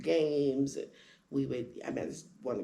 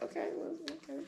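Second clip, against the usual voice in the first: much higher and much softer, timid; meek backing down; 13 semitones higher, 9 dB quieter than usual. Retold or imitated. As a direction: give in quietly, taken aback. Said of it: "but I was like".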